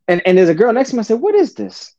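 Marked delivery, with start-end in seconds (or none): 1.2-1.9 baffled question. Retold or imitated. Baffled by strange phone ringing.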